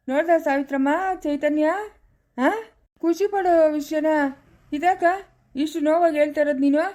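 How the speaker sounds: high, happy exclaiming; delighted. Acted. Grandfather hearing of grandson's new job, delighted.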